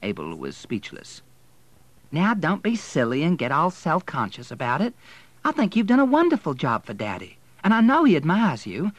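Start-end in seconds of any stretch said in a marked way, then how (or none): 2.1-8.9 high, breezy, placating lilt; airy consolation, voice lifted; patronising reassurance. A narrator's reading. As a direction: soothe condescendingly, pitched high. Woman breezily patronises rejected suitor.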